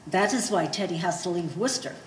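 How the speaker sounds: normal talking voice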